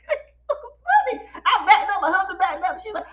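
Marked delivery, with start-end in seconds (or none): 0.0-1.3 high, stretched words through real laughter; helpless giggle while talking; laughing. Real, not acted. Cracks up at husband story.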